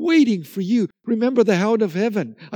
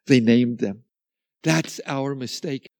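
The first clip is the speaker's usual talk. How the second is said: ordinary delivery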